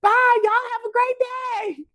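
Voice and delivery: put-on very high, drawn-out child squeal; cheery small-child falsetto; little brother. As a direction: chirp a slow goodbye like a little kid. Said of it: "his little brother said".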